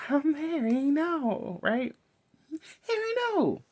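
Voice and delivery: high pleading mock voice; mock dismay. Viewer scolding Harry on screen, in a high whine. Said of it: "I was like".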